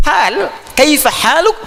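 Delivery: "kaifa haluk" drawn out slowly, each syllable full; slow classical Arabic. Shown as a demonstration. Teacher showing students the eloquent form, slow and high. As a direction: model the formal greeting slowly and carefully.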